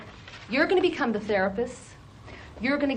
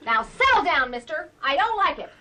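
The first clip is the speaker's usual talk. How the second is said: higher and louder, a sharp telling-off; stern scolding; 7 semitones higher, 8 dB louder than usual. Real, not acted. Therapist scolding the child.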